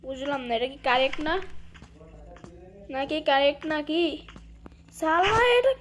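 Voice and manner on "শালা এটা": rising, higher-pitched annoyed outburst; exasperated. Real, not acted. Player cursing a stuck puzzle, exasperated. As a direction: voice climbing in exasperation. Puzzle in game will not work.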